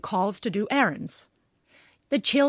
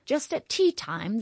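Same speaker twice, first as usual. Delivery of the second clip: plain reading voice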